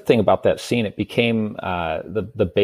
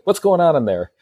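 higher-pitched demanding manager impression; intercom manager; 7 semitones higher than usual. Manager calls into the intercom about the jail noise, lightly acted. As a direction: lightly mimic a manager checking in. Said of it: "the manager one level up".

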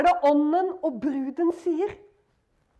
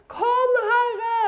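crying plea; 5 semitones higher, 3 dB louder than usual. Retold or imitated. Persecuted in Nigeria cry out.

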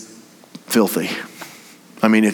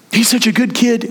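fond mother; 11 semitones higher than usual. Retold or imitated. Mother defending her son.

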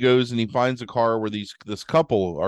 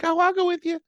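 put-on girly reply. Girl accepting party invite.

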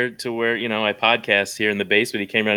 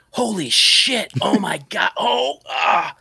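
high, slow mock shock, rising to a shout; overacted gasp of shock; shocked reaction; 7 semitones higher, much slower than usual. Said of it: "your initial reaction was just as".

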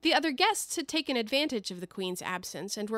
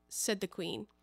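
usual speaking voice

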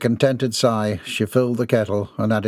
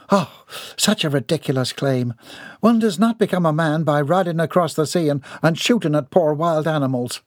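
higher, scoffing Southern lady; indignant lady; 6 semitones higher than usual. Acted. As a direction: dismiss the claim with ladylike scorn. Voices lady in story.

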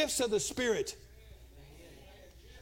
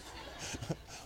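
soft breathy laugh; 11 dB quieter than usual. Real, not acted.

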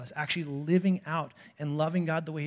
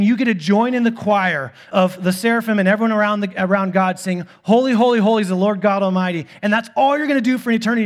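mock grand heaven pitch; 4 semitones higher, 11 dB louder than usual. Mocking heaven as endless choir.

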